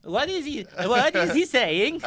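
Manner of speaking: high, loud, puzzled foreigner voice; baffled foreign soldier. Acted. Scandinavian soldier who can't follow the orders, high baffled voice. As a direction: play it bewildered, stretching the words.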